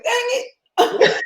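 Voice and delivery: high loud dang, then laughter; laughing frustrated shout. Retold or imitated. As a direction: blurt it, then crack up. Retold frustrated exclamation, laughing.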